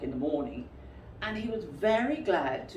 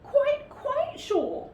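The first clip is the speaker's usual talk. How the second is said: much higher, very slow insistence; insistent Pooh; 5 dB louder, much slower than usual. Acted. Pooh assuring Rabbit, very high and slow. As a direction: drag it out firmly.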